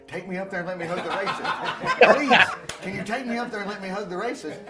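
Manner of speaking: hammed-up excited begging; eager begging. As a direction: plead excitedly to be let in on it. Students begging to join the prank.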